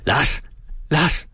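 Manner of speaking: calling a name across the yard. A narrator's reading. Calling the coachman at night. (kalder på Lars over gården)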